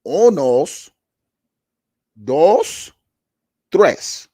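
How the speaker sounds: loud, drawn-out uno dos tres; counting in Spanish. Real, not acted. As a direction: belt each number with a pause. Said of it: "Let me say it in Spanish".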